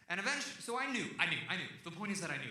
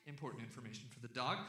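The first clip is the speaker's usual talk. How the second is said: normal talking voice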